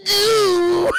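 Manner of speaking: high, loud drawn-out wail; mock climactic cry. Streamer mimics Anakin as he touches her back, mock climactic cry. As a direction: cry it out absurdly high and long.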